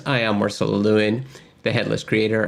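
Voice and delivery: normal talking voice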